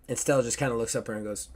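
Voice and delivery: normal talking voice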